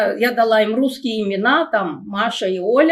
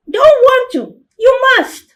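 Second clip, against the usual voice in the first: put-on much higher and louder, a stubborn child; little girl's voice; 12 semitones higher, 7 dB louder than usual. Little sister refusing her dinner, high child's pout. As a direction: pout it out like a small kid refusing. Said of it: "change my voice to make it sound like a children's voice".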